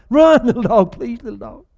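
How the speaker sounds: high, sobbing-style pleas for the dog; mock weepy moviegoer. Mocking audiences who cry over a dog, high hammed-up wailing. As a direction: ham up the tearful panic.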